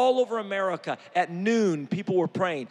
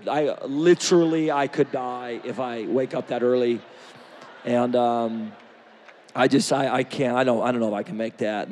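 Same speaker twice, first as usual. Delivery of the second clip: lower, stammering whine of an excuse; mock lazy friend; 8 semitones lower than usual. Preacher mimicking a friend who won't get up early, lower mock whine. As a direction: play the sleepy friend making excuses.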